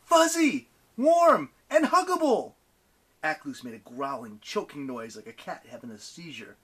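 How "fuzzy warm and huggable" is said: high, loud shout; goading, high-pitched yell; yelled taunt. A narrator's reading. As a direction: yell it like an insult. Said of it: "Percy yelled".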